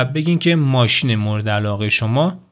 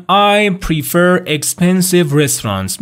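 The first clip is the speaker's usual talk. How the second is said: "I prefer expensive" slow and higher; stretched classroom model; slow model sentence; 5 semitones higher, 3 dB louder, much slower than usual. Shown as a demonstration. Teacher modelling a sentence for students. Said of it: "model sentence".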